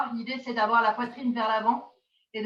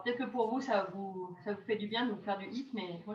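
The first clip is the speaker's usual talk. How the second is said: ordinary delivery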